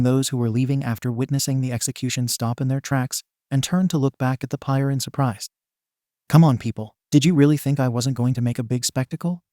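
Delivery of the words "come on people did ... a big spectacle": ordinary delivery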